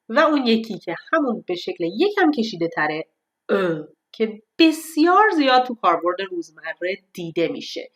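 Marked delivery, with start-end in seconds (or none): none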